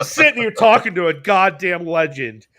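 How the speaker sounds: ordinary delivery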